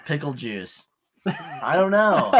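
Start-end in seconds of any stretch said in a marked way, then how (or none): 1.3-2.3 laughing. Real, not acted. Laughing at own silly guess.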